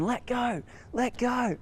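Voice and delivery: high-pitched shout of let go; retold screaming. Caleb screaming at the dog to let go, high yell. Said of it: "he's still screaming".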